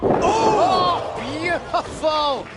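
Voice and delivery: loud, high, slow awed call; excited. Real, not acted. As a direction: marvel at the move out loud. Commentator awed by spinning brainbuster.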